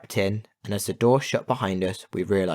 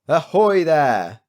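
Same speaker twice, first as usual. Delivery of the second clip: loud, slow sea captain hail; calling out; 8 semitones higher, 8 dB louder, slower than usual. Acted. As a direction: hail them across the sky. Ship captain calls out.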